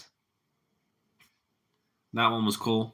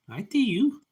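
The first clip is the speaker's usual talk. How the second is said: pet talk; 11 semitones higher than usual. Real, not acted. Talking to the bird.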